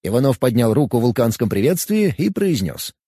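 plain reading voice

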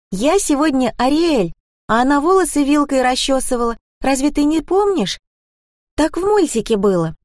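put-on high-pitched little girl voice; young daughter explaining. A narrator's reading. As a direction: explain it earnestly like a small child. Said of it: "объяснила дочь".

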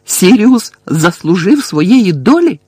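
high, loud accusing shout; shouted question. A narrator's reading. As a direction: yell it as an accusation. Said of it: "крикнув Гаррі".

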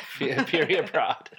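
laughing through the name. Real, not acted. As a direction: chuckle the words out.

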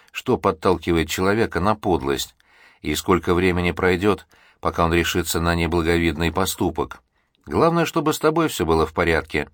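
usual speaking voice

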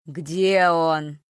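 low, loud skull voice; low eerie voice. Acted. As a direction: rumble it from a skull. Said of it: "низким и жутким голосом".

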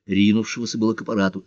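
ordinary delivery

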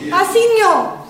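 loud call across a field. Acted. A woman calling out to the cicory pickers, loud. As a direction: hail the women from a distance.

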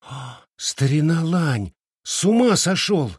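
gasp, then a high shocked cry. A narrator's reading. Girl sees her friend's bleeding finger, crying out. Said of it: "закричала Фэн Хуан".

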